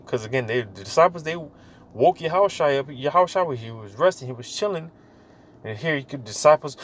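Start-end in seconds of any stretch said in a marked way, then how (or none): none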